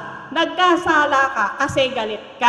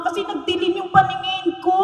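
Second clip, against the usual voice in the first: normal talking voice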